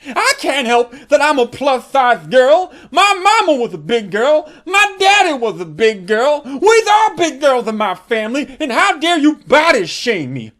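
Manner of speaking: imitating her huffy rant; mock indignant. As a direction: play the offended girl, loud. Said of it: "And she said".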